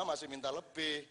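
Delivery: normal talking voice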